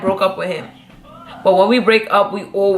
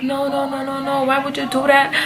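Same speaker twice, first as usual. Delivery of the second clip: imitating pleading boyfriend; 6 semitones higher than usual. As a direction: play him begging her not to leave. Boyfriend pleading after breakup.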